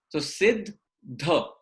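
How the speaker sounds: सिद्ध ध slowly, with a single aspirated ध; slow careful pronunciation. Shown as a demonstration. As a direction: spell out सिद्ध ध slowly for the class. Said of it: "हम सिद्ध बोल रहे हैं". (धीरे से साफ उच्चारण सिद्ध ध)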